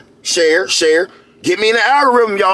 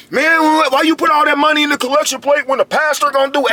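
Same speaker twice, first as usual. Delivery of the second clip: mock complaining churchgoer; 9 semitones higher than usual. Mocking churchgoers complaining about collection plate.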